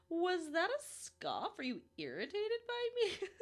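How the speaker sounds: high, slow, laughing mock indignation; laughing, teasing. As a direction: giggle the question at the therapist, high and drawn out. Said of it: "I was like".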